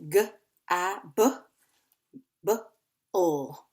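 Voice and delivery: "G A B B O" as isolated sounds, guh, ah, buh, buh, ul; sounded out. Shown as a demonstration. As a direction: sound out "G A B B O" piece by piece with pauses. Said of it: "see if you can do it the same way".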